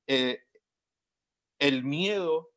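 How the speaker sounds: ordinary talk, nothing marked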